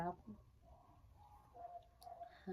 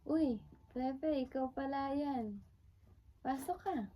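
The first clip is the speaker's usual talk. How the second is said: higher-pitched hello to an unexpected visitor; acted surprise on recognizing a guest; pleasantly surprised greeting; 6 semitones higher than usual. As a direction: greet an old friend at the door, pitched up. Acted greeting of arriving visitor Pepe.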